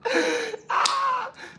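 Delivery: very high, loud burst of laughter; uncontrolled high-pitched cackle; shrieking laugh. Real, not acted. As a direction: howl with laughter. Cracks up at own impression.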